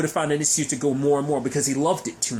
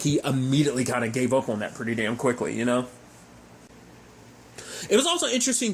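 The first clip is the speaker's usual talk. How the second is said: ordinary talk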